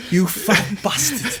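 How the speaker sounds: slow drawn-out mock insult; imitating the actor. Mocking the actor's catchphrase, slow. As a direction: stretch the insult out like the actor does. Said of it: "That's when he goes".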